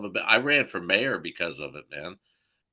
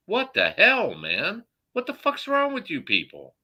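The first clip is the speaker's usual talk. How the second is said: exasperated outrage; 10 semitones higher than usual. Real, not acted. Venting to the hosts about the pay gap, high and exasperated. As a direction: let it burst out high with disbelief. Ranting at listeners.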